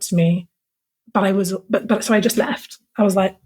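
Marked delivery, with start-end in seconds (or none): none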